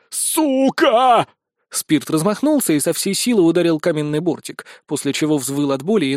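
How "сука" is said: high, drawn-out angry yell; furious curse. A narrator's reading. Wizard kicks stone ledge in rage, furious yell. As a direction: yell it out in frustration.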